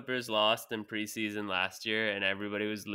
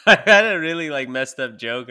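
laughing; 5 semitones higher, 13 dB louder than usual. Real, not acted. Bursting out laughing at own joke.

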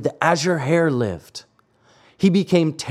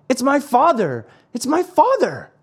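high, alarmed outcry; sharp cry; 10 semitones higher than usual. A narrator's reading. Pinocchio spotting his father at sea, sharp cry. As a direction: cry out in shock, pitch climbing on the repeat. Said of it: "then gave a sharp cry".

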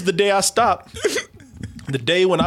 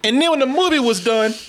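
usual speaking voice

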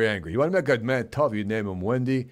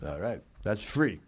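usual speaking voice

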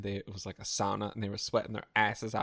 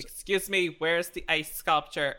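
bossy coordinator voice; 5 semitones higher, 6 dB louder than usual. Pretend. Bossy wedding coordinator role.

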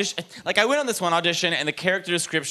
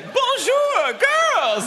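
put-on Julia Child; much slower than usual. Comic hearing himself as a TV chef, high trilling shout. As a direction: sing out like a gushing TV chef. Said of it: "Am I Julia Childs?".

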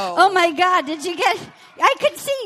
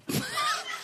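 cackling laugh; 12 dB quieter than usual. Real, not acted. Cackle at the joke.

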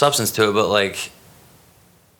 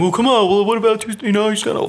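creepy pushy guy; 11 semitones higher than usual. Retold or imitated. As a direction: play the pushy creep begging for a date. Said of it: "be fucking, you know, creepy and like".

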